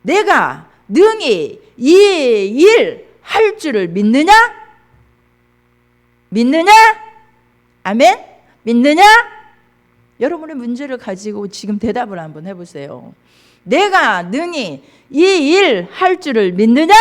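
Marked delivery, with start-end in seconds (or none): 0.0-9.0 loud, raised, preacher's demanding question; forceful challenge. Acted. Acts Jesus' question, challenging congregation. 13.6-16.9 loud, raised, preacher's demanding question; forceful challenge. Acted. Demands answer from congregation.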